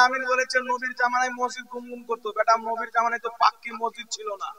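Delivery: quiet aside. Real, not acted. Preacher questioning a claim about the mosque, quiet aside. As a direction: drop right down in volume. Dismissive aside within the sermon.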